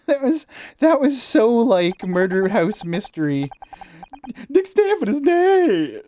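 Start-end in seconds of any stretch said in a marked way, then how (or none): 4.5-6.0 high, slow melodramatic pronouncement; milk the death news like a soap opera; overdramatic announcement. Shown as a demonstration. Announcer melodramatically reveals the host's death. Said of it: "why did I read it like that?".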